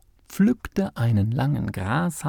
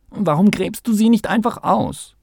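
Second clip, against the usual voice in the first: hoch und laut drängelnd; ungeduldig; 9 semitones higher, 5 dB louder than usual. A narrator's reading. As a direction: ungeduldig auf Tempo pochen. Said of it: "trat ungeduldig von einer Pfote". (quengelnder Maulwurf)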